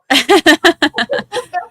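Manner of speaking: high, loud burst of laughter. Real, not acted. Laughing about screaming on the porch, loud burst of laughter. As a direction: let a big laugh burst out.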